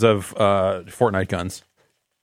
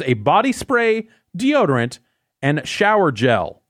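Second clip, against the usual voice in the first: ad read; 5 semitones higher than usual. Acted. Reads the product description aloud.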